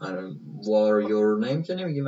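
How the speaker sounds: ordinary talk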